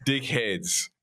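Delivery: put-on New Zealand accent, slow, flattened vowels; drawn-out Kiwi accent.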